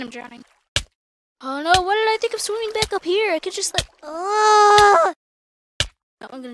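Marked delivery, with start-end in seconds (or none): 1.4-3.9 high, loud, flustered complaint; panicked exasperation. Real, not acted. Realises mistake while drowning in game. 4.0-5.1 long, loud, high "aah" of panic; frustrated scream. Real, not acted. Drowning in game, screams.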